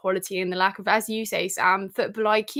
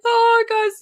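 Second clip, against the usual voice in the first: real teary laugh; 11 semitones higher, 5 dB louder than usual. Real, not acted. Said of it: "why am I getting emotional".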